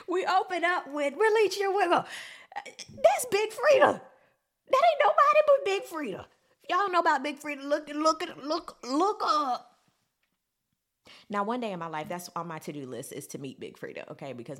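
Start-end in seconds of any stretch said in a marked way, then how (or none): 0.1-9.7 high-pitched, loud hype; excited fan raving. Real, not acted. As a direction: rave at the top of your voice like a thrilled fan. Fan raving about [name]'s feature.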